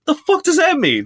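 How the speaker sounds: high-pitched incredulous question; baffled knee-jerk reaction. Acted. Host imitating their knee-jerk reply to a weird text, baffled.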